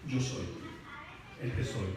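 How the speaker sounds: muy grave y suave, con pausa; cita solemne. Acted. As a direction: baja la voz, casi íntima y pausada. Solemn repeated quote of God. (solemne, en voz muy baja)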